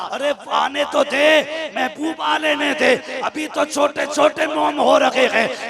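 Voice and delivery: loud, high, impassioned sermon voice; full-throated preacher's cry; fiery preaching. Acted. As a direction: preach it at full force to the crowd. Preaches the miracle at full force.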